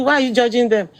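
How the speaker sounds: usual speaking voice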